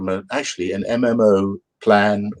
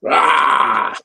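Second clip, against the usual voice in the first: monster roar; 7 semitones higher than usual. Acted. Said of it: "I got to reveal the true horror".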